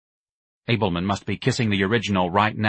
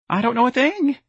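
very high, piping voice; piped denial; 4 dB louder than usual. A narrator's reading. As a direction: pipe up in frightened denial. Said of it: "Mister Gonzalez piped".

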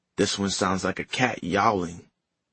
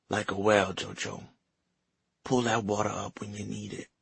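weak grandmother; 5 dB quieter than usual. Acted. Dying grandmother speaks.

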